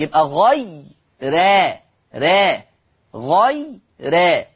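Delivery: very slow, spell out غي را with a thin ra; drawn-out thin ra. Shown as a demonstration. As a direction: stretch غي را syllable by syllable. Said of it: "يبقى حكم الراء الترقيق".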